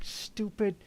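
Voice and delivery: ordinary delivery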